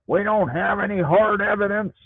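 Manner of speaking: high, whiny mock voice of the cops brushing her off. Mocking the sheriff's deputies who shrug off the old lady, in a high whiny voice. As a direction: sneer it like a dismissive deputy. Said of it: "they're like".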